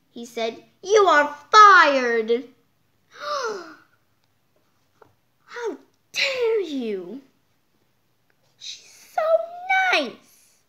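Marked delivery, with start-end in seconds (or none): 0.8-2.5 loud, slow boss shout; slow furious boss shout; boss yelling. Acted. Boss firing the lunch lady. 3.2-3.7 sharp gasp of shock; shocked high gasp. Acted. Shocked reaction to firing. 5.4-10.6 high indignant protest; high outraged child voice. Acted. Outraged defence of Roz.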